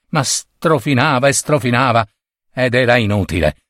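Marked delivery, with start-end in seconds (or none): none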